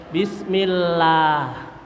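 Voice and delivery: bismillah slowly with a full open A; pure A bismillah. Shown as a demonstration. Quran teacher models bismillah without an E sound, slow. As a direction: stretch bismillah with a clean A. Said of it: "bebas patah itu dari E dan E".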